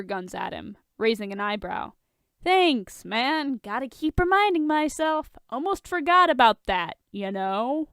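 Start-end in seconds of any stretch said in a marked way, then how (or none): 2.4-7.9 higher, louder mock gratitude; sarcastic thanks. A narrator's reading. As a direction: throw the thanks back dryly. Sarcastic reply to tactless remark.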